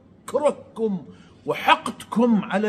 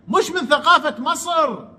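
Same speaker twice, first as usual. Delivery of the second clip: higher, sneering repeat of مش من ثقافة مصر; mocking echo; 8 semitones higher than usual. Vlogger mocking Amr's claim about Egyptian culture, sneering echo. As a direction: throw his words back with scorn.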